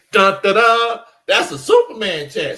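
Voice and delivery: high, loud announcer-style drumroll call; hammed-up fanfare. Host heralds a Superman chat from a listener, hammed-up fanfare.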